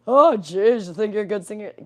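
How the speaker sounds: loud, exaggerated critic's jeer; mock scoffing. Critics taunting her about her singing, mock scoffing. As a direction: imitate a critic, starting high and loud.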